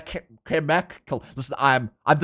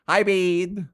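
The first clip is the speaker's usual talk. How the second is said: ordinary delivery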